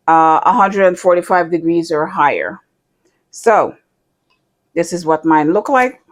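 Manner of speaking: ordinary talk, nothing marked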